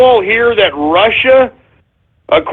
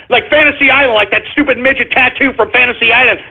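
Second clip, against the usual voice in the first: ordinary delivery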